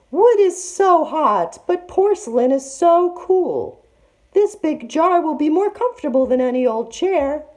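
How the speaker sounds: very high, sugary falsetto; fake auntie voice. A narrator's reading. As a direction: lure the girls with a treat. Disguised tiger voice.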